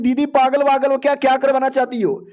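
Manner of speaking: high-pitched, exasperated outburst; indignant protest. A narrator's reading. Brother confronting his sister over her scheme, high indignant protest.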